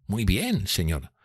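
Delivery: soft, deferential servant's reply; bow and answer quietly; deferential waiter. A narrator's reading.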